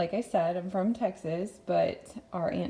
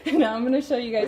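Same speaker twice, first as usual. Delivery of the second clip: laughing; 5 semitones higher, 7 dB louder, faster than usual. Real, not acted. Still laughing after saying.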